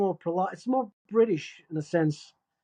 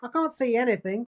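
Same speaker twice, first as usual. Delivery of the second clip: broad Australian accent, pitched up; Furiosa Australian accent; 4 semitones higher than usual. Shown as a demonstration. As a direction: keep up a strong Aussie accent. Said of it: "Do the Furiosa one again".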